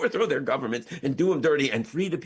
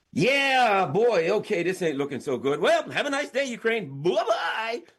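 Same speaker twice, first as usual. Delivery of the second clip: mock breezy goodbye; 5 semitones higher than usual. US voiced abandoning Ukraine.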